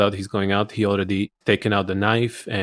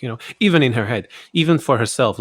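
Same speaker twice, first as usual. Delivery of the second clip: ordinary delivery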